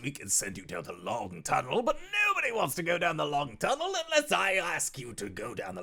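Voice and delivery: high, loud, fast demon lord cutting him off; gleeful tyrant lording it over guests; theatrical demon lord. Acted. GM voices Rakdos.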